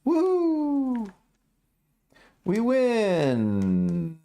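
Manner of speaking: high drawn-out mock victory cheer; long, high playful whoop. Mock cheering at leading the plunge.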